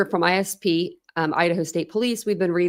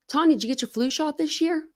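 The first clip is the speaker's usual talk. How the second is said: a hint of mom's higher questioning voice; imitating mom; 10 semitones higher than usual. Mother asks her grown daughter, lightly imitated higher voice. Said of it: "my mother asked me".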